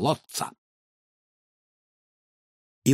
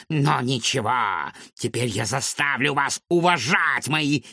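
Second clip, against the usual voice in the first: mad scientist; 5 semitones higher than usual. Acted. Villain boasts of inventions.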